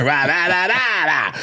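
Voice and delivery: rhythmic "rah" noises in the repeated cadence; mocked comic's one-size-fits-all noise; refrigerator sound effect. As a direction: chant five bouncing rahs.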